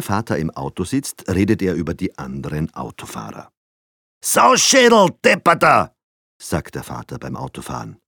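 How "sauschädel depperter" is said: high, loud insults at other drivers; angry driver cursing. A narrator's reading. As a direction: yell abuse from behind the wheel. Father curses other drivers.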